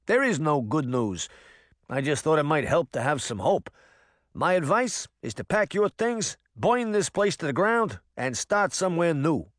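a nervous cat veteran in broad New Jersey; rattled New Jersey accent; thick New Jersey accent. Acted. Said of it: "in a thick New Jersey accent".

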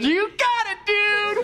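very high-pitched, gleeful cry; excited catchphrase. Acted. Greeting his old babysitter who remembers him, excited squeal. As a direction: squeal it like a little kid. Celebrating being recognized, catchphrase.

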